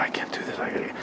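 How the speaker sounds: whispered inner voice; barely voiced private worry; thinking to himself. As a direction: say it under the breath, reluctant. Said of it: "he's like thinking".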